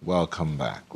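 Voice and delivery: normal talking voice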